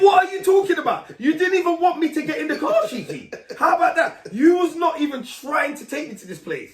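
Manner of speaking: high, loud, outraged comeback; mock-outraged yelling at a boastful brother; indignant shout. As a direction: call out your brother's lie. Arguing back at the brother.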